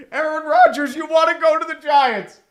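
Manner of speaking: high loud plea to Rodgers; loud pleading. Real, not acted. Fan pleading with Aaron Rodgers, high and loud. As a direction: call out to him begging.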